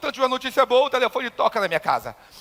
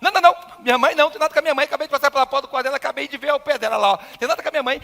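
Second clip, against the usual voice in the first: fast, higher-pitched, louder protest; panicked, tumbling insistence; desperate denial; 5 semitones higher, 3 dB louder, faster than usual. Retold or imitated. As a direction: blurt it out in retold panic. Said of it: "Me bateu um desespero".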